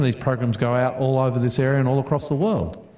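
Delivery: ordinary talk, nothing marked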